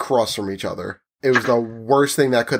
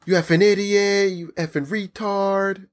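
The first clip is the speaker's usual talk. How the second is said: higher mock-angry name-calling; imitating insults; 8 semitones higher than usual. Retelling how the customer insulted the worker, higher mock yell. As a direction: spit the insults like the irate man, pitched up. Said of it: "starts calling me".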